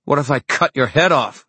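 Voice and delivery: cocky mocking challenge; taunting. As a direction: needle him with a grin. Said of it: "Emma taunted".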